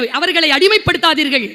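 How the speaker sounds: ordinary talk, nothing marked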